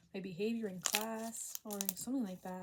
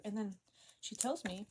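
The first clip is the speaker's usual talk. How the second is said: normal talking voice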